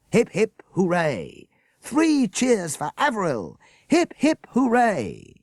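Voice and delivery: high, loud, slow cheerleading shouts; rally the crowd name by name; leading cheers. A narrator's reading. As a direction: headmaster leads cheers for each child.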